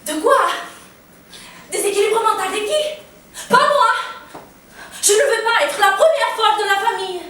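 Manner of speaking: loud, high-pitched refusal; indignant protest. Acted. Patient refusing to be the family's madwoman, loud and indignant. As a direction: reject it outright, rising in outrage.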